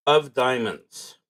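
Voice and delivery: ordinary talk, nothing marked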